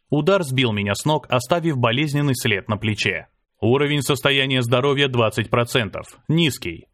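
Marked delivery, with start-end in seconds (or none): none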